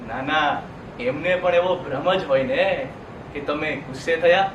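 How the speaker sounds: low, hushed mock denial; low-pitched, knowing dismissal; sarcastic reassurance. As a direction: drop low and needle them gently. Sarcastically denies the audience's anger.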